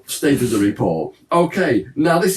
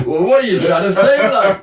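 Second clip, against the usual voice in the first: put-on hammy Geordie accent, higher and louder, laughing; bad Geordie accent; 6 semitones higher, 5 dB louder than usual. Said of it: "we seem to do a bad Geordie accent".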